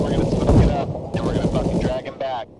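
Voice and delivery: loud, forceful command; shouting orders over battle. Real, not acted. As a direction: yell the plan over the noise. Rescuing downed teammate.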